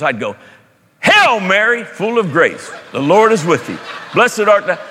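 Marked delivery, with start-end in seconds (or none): 1.3-4.8 imitating a kid hitting Hail Mary hard and high; emphatic Hail Mary. Pastor recalling his childhood prayer, Hail Mary said with gusto. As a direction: punch the first words like a shout. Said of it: "the only thing I ever said with emphasis".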